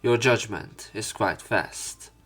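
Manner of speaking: normal talking voice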